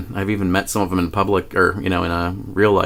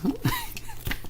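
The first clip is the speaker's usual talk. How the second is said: high squeaky laugh; 13 dB quieter than usual. Real, not acted. Laughing at her weird claim.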